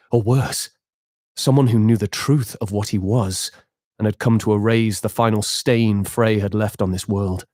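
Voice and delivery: steady storyteller narration; story narration. Acted. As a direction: read it like a fantasy tale. Game master narrates the scene.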